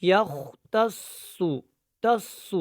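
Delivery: slow یختص with a heavy, full s; deliberate full-mouthed saad in یختص; thick saad. Shown as a demonstration. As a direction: round and darken the held s of یختص. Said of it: "سواد موٹا ہے".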